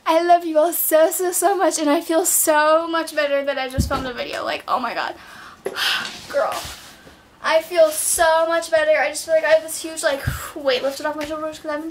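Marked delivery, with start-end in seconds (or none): 5.6-6.2 quick breathy exhale; relieved sigh. Real, not acted. Vlogger relieved the video is filmed, a sigh.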